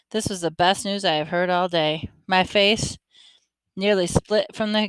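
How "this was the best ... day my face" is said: usual speaking voice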